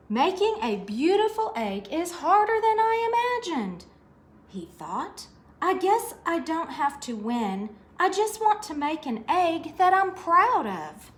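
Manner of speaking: a hint of little rabbit resolve; lightly voiced Hoppy musing. Hoppy thinking under tree.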